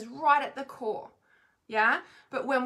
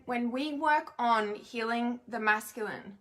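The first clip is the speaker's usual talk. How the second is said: ordinary talk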